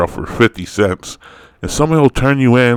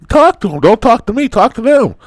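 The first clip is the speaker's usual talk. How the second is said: exasperated outburst; 13 semitones higher than usual. Real, not acted. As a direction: throw the question back at them, fed up. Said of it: "exasperated repeated command".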